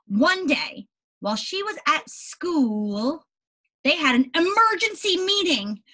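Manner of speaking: put-on slow storybook reading to children; unhurried read-aloud for young listeners; story narration. A narrator's reading. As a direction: read it slowly, like to a class. Reads story aloud to class.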